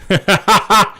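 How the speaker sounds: short burst of real laughter. Real, not acted. Streamer laughs at his own complaint, a short burst of laughter. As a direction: laugh it off out loud. Laughs after hating it here.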